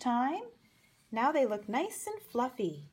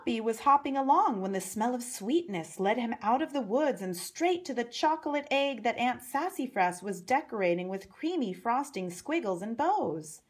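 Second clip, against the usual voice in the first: plain reading voice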